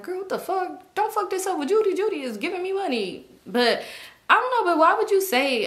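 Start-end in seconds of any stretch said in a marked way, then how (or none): 0.0-3.2 high, put-on complaining voice; mock him in a raised pitch; high-pitched mocking imitation; imagined man's plea. Imagines what he probably said.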